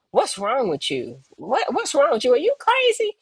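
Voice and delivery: high, disbelieving mom's rebuke; mother scolding. Retold or imitated. Mother scolds screaming daughter.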